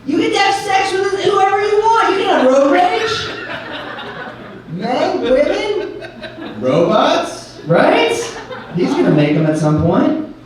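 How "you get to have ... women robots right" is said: high, giddy riff, laughing; excited. Real, not acted. Comic cheering the crowd on, high and laughing. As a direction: get carried away, voice up high.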